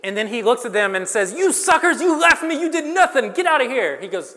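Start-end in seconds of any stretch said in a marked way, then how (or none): 1.3-4.0 high, loud mock tirade; hammed-up scolding, pitched way up; mock angry outburst. Imagined Jesus telling off disciples who left him, mock angry outburst.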